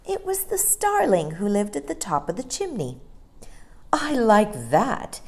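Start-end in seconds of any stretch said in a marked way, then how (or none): 3.9-5.2 slow, higher offended nanny; a hint of a huffy nanny; indignant Mary Poppins. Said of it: "said Mary Poppins".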